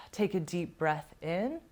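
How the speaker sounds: soft, unhurried meditation voice with long pauses; guided meditation. Real, not acted. Yoga teacher settling her class, soft guided meditation.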